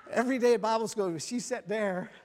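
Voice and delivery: loud, high, laughing through the words; can't stop laughing as he tells it; laughing while telling. Real, not acted. The preacher recounts following Sister Maria around, laughing loudly.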